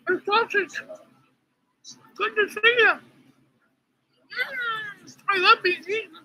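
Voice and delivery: tiny high cartoon food voice; high squeaky sausage voice. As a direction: squeak like a cheerful talking sausage. Talking sausage says hi.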